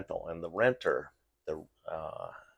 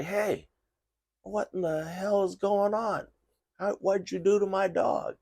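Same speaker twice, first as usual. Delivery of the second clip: much higher, indignant renter voice; angry renter; 12 semitones higher than usual. Retold or imitated. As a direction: play the outraged owner demanding answers. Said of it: "and say".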